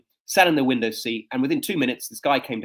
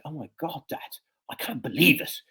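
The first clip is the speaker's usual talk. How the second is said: amazed whisper; 3 semitones higher than usual. Retold or imitated. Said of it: "she looked at me at this amazement".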